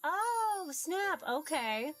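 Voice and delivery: normal talking voice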